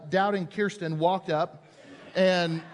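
ordinary delivery

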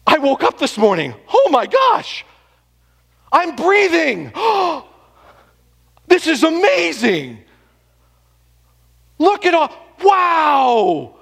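high, loud gasping wonder, slow; overjoyed at simply breathing; mock amazed awe. Pastor acting a believer amazed to wake up, high gasping wonder. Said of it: "Do we question it?".

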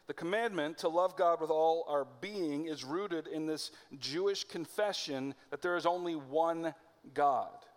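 ordinary delivery

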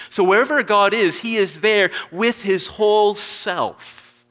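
exultant sermon climax; full-force preaching. Real, not acted.

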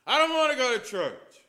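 put-on high, loud whiny child shout; a boy shouts down from his room; child yelling. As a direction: yell it like a stubborn little boy. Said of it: "he was yelling from his room".

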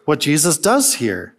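usual speaking voice

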